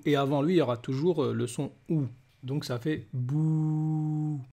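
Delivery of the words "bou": "bou" stretched slowly on a level note; long vowel. Shown as a demonstration. Teacher applying the prolongation rule, "bou" drawn out. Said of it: "ça va être une prolongation".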